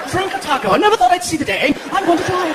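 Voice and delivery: high, eager and amazed; excited tourist. Retold or imitated. Tourist thrilled to hear about drinking a taco, high and eager.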